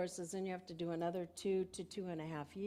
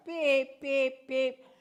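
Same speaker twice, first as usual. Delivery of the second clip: loud, high imitated beeps; mock car beeping; 6 semitones higher, 10 dB louder, much slower than usual. Sermon speaker imitates the car beeping while driving grandson. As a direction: copy the seatbelt alarm beeping at her.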